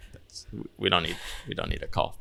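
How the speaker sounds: soft, low, retold concession; quiet backing down. Client conceding to the host that no call is needed, quietly. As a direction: trail off softly, nearly under the breath.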